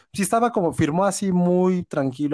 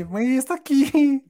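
much higher, drawn-out mock protest; queja imitada; 11 semitones higher, slower than usual. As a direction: stretch it out in a high, plaintive retold voice. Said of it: "yo así". (queja aguda y alargada, imitándose)

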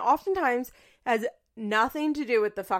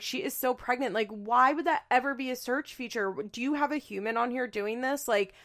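ordinary delivery

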